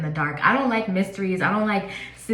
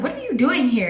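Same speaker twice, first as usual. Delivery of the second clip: higher and louder, startled; shocked question; 7 semitones higher, 5 dB louder than usual. Retold or imitated. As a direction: blurt it out in astonishment. Sees grandfather's ghost at funeral.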